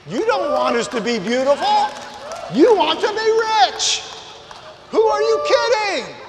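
loud, high preaching, shouting the point home; preaching at full force. Acted. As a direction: preach it big and loud, mocking the ad claims.

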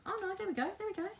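soft, high, coaxing there we go. Real, not acted. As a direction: coax it along softly and high. Soothing the crayon as it spreads.

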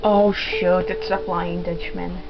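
usual speaking voice

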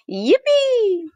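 long, high-pitched cheer of "yippee"; gleeful yell. A narrator's reading. Children cheering at going sledding, gleeful yell. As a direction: draw out a high, happy yell. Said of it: "everybody yelled".

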